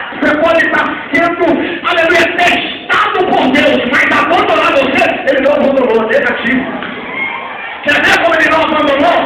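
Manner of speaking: loud rising preacher's build; preaching. Acted. Pastor preaching to the church with rising force. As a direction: preach it out to the congregation, pushing harder.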